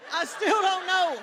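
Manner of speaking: high, loud laugh through the words; real laugh at herself, up high. Real, not acted. Laughing at forgetting her act.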